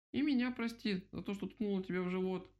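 high-pitched little mouse voice; squeaky mouse. A narrator's reading. Mouse apologising to the owl, high squeak. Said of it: "пропищала мышка".

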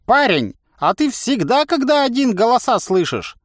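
cackling toothless ranting. Acted. Old man ranting.

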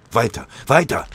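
high, loud call; shouted command to keep running; urgent shout. A narrator's reading. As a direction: urge them on at the top of your voice. Urging comrades on while fleeing fire.

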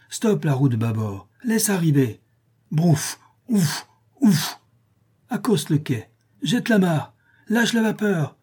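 high called-out docking orders; boy imitating captain and steam engine; play steamboat captain. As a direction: call commands between puffs of a pretend engine.

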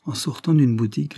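usual speaking voice

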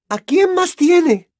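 high, loud, drawn-out demand; fierce challenge. A narrator's reading. Mother challenging the godfather over the boy, fierce. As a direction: challenge him loudly, slowly, high-pitched.

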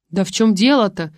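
ordinary delivery, nothing marked

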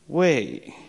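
retold kid's sudden puzzled question; curious boy. Voices the boy's second question.

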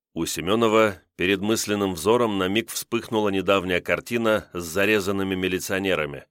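plain reading voice

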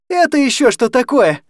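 high, loud outraged shout. Acted. Anya shouting at her guardian in bed, outraged. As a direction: yell it at the drunk guardian. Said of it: "крикнула Аня на опекуна".

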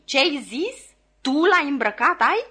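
high, loud, indignant questions; angry outburst. A narrator's reading. Lawyer cutting off his helper's blunder, exploding. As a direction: explode in outraged disbelief. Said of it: "tutorele meu izbucni".

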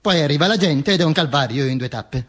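usual speaking voice

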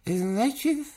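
put-on high, slow toothless old man; toothless mumble. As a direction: mumble through no teeth. Old chairman voiced.

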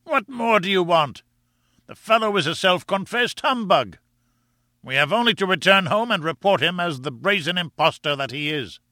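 high-pitched crowing professor; derisive professor. Acted. Professor denounces his rival as humbug, high and derisive. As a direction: press the scornful point home in a high voice. Summerlee crows over blank letter.

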